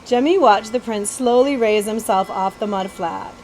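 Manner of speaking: ordinary delivery, nothing marked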